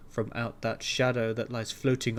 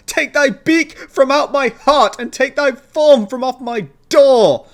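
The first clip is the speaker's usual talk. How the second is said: much higher, much louder frenzied yell; frantic shriek; 6 semitones higher, 14 dB louder than usual. A narrator's reading. Narrator ordering the raven away, frantic shriek. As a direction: drive the bird out with fury.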